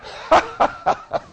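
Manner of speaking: a quick, breathy scoffing laugh; mocking laugh. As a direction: laugh down at them. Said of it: "they were laughing".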